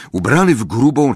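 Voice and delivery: plain reading voice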